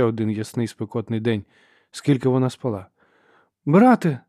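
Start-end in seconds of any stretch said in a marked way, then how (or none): 3.6-4.2 high, loud call to her brother; woman calling out. A narrator's reading. Sister hunting with her brother calls out to him. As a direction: holler from a way off. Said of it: "гукнув жіночий голос".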